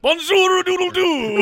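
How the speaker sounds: high, loud mock rooster with French flair; French rooster crow.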